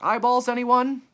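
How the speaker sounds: high, loud vendor's call; loud, carrying offer. A narrator's reading. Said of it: "came a call".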